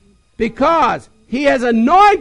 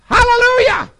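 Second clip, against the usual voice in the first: high, loud burst of praise; joyful hallelujah; 10 semitones higher, 3 dB louder than usual. Real, not acted. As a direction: shout it up in joy. Preacher's exclamation.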